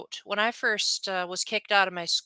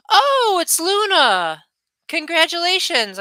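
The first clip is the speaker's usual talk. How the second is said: delighted recognition; 10 semitones higher, 7 dB louder than usual. Real, not acted. Viewer Luna arrives after long absence.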